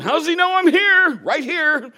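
high, loud incredulous outburst; mock-indignant high yell; loud mock disbelief. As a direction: blurt it out in exaggerated bewilderment. Thinking loudly at blind man approaching.

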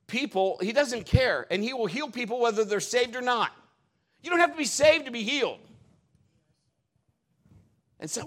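full-force preaching. Acted. Preacher exhorting the congregation about healing, loud and high. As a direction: preach it to the back row.

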